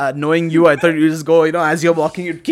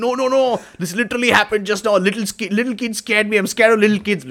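higher-pitched, insistent imitation; mock protest; 4 semitones higher, faster than usual. Forgetful friend insisting to the others, mock protest. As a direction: protest in a raised, quick mock voice.

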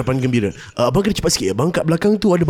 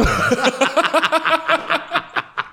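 high burst of laughter; 12 semitones higher than usual. Real, not acted. Laugh breaking character.

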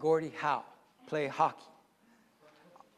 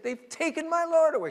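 weeping Mary; 10 semitones higher, 5 dB louder than usual. Acted. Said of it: "she's weeping".

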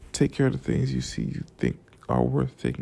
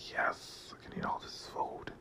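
gleeful whisper; 13 dB quieter than usual. Retold or imitated. Gleeful at food.

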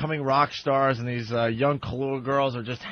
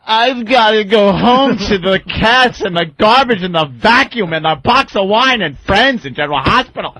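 loud, higher, exasperated ranting; mock refusal; 5 semitones higher, 8 dB louder than usual. Comic hams up turning down the girl at the gig, loud. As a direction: list the lame chores loud and fed up.

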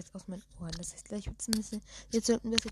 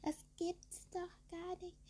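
mocking voice; 9 semitones higher, 6 dB quieter, slower than usual. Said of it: "die Leute, die jetzt sagen".

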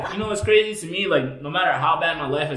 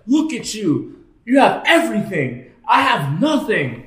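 higher, drawn-out mock lament; aggrieved homeless man; 4 semitones higher, slower than usual. Friend imitating a homeless man in Barcelona, aggrieved outcry. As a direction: plead it high, slowing at the end.

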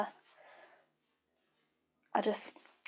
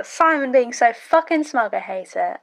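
ordinary talk

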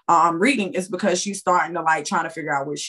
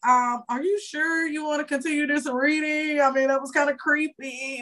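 lightly voiced uneasy friend, higher pitch; hesitant friend; 7 semitones higher, 3 dB quieter than usual. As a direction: voice a friend's wary doubt, pitched up. Friend hesitates about continuing creepy reading.

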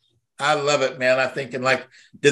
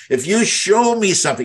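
ordinary talk

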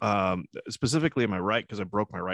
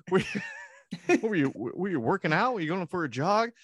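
much higher, mock-nosy questioning; high teasing caller; 10 semitones higher than usual. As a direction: tease a winded friend. Caller teasing breathless answerer.

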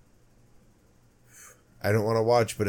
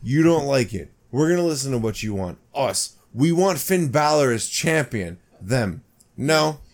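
higher, louder back-and-forth; mock shouting match; 5 semitones higher, 5 dB louder than usual. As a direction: play both sides of a shouting match. Acting out fans versus company.

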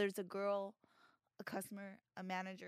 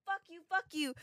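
retold scream; 7 semitones higher than usual. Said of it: "I'm, like, screaming".

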